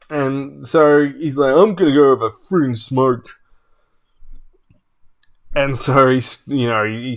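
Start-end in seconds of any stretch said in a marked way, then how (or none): 1.5-3.3 mock-annoyed announcement, a bit louder; retold huffy exit. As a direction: imitate a sore loser stomping off. Imitating loser storming off.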